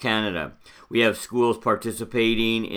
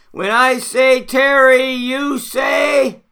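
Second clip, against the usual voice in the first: very high, loud climactic shout; camp counselor cheer; 12 semitones higher, 6 dB louder, slower than usual. Acted. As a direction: whip the crowd into a frenzy. Leading a school cheer.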